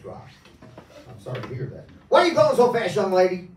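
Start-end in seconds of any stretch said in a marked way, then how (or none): 2.1-3.5 Southern cop. Acted. Hypnotist playing the Southern cop, loud Southern accent. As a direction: bark it like a Southern sheriff. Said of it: "he speaks in a southern accent".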